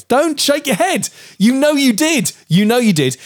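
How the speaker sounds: high, mocking accusation; mock accusing wife. Husband imitating his wife accusing him. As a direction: pile it on like the wife jabbing.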